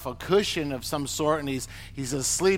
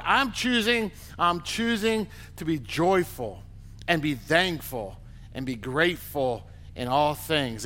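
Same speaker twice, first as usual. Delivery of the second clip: ordinary talk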